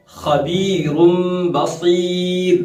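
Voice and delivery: खबीरुम बसीर stretched slow with a thick throaty kh; full khaa. Shown as a demonstration. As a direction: recite खबीरुम बसीर slowly, heavy fricative kh. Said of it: "हम खा को पढ़ते हैं". (खबीरुम बसीर का खा हलक से, मोटा)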